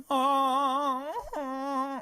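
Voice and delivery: high, drawn-out "oh" of awe; mock moaning. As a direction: moan high, loud at first, in mock amazement. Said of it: "they're like".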